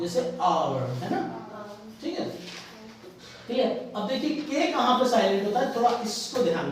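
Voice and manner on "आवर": "आवर" opening on the vowel, no H; silent H. Shown as a demonstration. Teacher giving students another example, "आवर" with silent H. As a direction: say "आवर" lower, starting straight on the vowel. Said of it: "H को ना बोल के".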